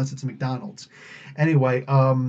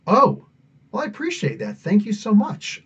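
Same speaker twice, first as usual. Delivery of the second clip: higher-pitched grateful "oh"; politely taken aback, pitched up; retold surprised thanks; 7 semitones higher than usual. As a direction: act out pleasantly surprised thanks. Retold grateful reply to the warning.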